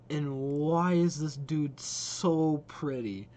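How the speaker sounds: loud, drawn-out gush; swooning admiration. Real, not acted. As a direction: gush over it, stretching the words out. Gushing over character in trailer.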